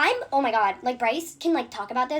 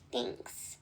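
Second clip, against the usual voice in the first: curt whisper; 12 dB quieter than usual. Retold or imitated. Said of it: "she goes".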